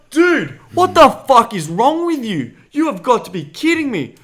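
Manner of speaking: retold high, loud disbelieving rant; exasperated outburst. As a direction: shout it high at a clueless mate. Acts out exasperated reply to friend.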